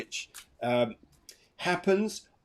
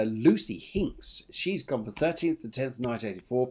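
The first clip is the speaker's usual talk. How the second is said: normal talking voice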